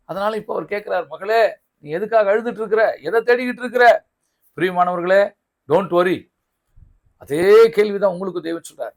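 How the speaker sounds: high, forceful sermon delivery; impassioned preaching. Real, not acted. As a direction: press the question on the congregation, loudly.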